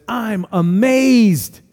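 stretched-out, emphatic astonishment; appalled amazement. Acted. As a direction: draw out "amazed" in disbelief. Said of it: "He looks on and he says".

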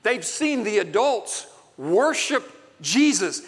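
ordinary talk, nothing marked

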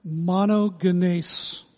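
"Monogenes" drawn out slowly as MAH-no-geh-NACE; slow teaching model of "Monogenes", ending "-nace"; Greek pronunciation. Shown as a demonstration. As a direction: lead a repeat-after-me "Monogenes", hard g. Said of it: "Would you say that with me?".

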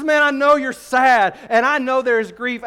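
compassionate Paul. Retold or imitated. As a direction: comfort grieving friends with feeling. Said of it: "He says".

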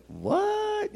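very high, soft comic what; baffled. Retold or imitated. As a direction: squeak it softly in mock bafflement. Acts confusion at parables.

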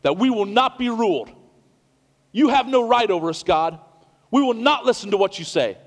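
loud, high defiant shout; bold defiant declaration. Acted. Humanity shouting defiance at God, loud and high. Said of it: "their bold declaration".

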